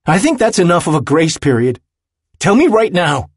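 high, loud, building to an order; angry shouting. A narrator's reading. Willem snatches the keys from his friend, shouting. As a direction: lose patience and demand it. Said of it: "he shouts at Jude again".

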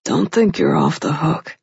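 low husky voice. A narrator's reading. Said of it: "his voice low and husky".

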